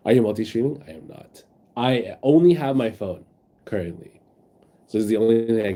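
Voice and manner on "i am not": whisper. Real, not acted. Unvoiced aside.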